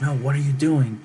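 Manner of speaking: high, loud alarmed outcry; alarmed protest. Retold or imitated. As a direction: imitate onlookers crying out in alarm. Acted panicked onlookers in retold scene.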